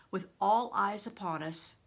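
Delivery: ordinary delivery, nothing marked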